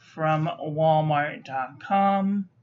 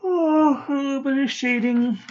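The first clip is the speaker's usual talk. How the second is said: surprised; 7 semitones higher, 6 dB louder than usual. Real, not acted. Surprised reaction to paper.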